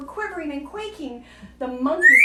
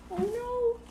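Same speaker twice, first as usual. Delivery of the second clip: usual speaking voice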